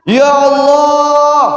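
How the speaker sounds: drawn-out, high, held cry; dying man's shout. Acted. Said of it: "suami saya ini bengok-bengok". (teriakan panjang orang sekarat)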